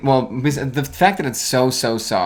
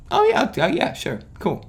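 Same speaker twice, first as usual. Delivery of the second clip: unimpressed shrug; 10 semitones higher than usual. Pretend. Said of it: "I'll be like".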